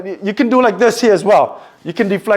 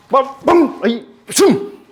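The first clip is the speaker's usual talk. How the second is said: strike sounds; 7 semitones higher, slower than usual. Shown as a demonstration. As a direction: punch out the sound effects as you strike. Repeating the strike demo.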